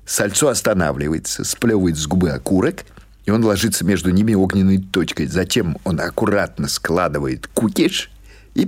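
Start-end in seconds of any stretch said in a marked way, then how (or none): none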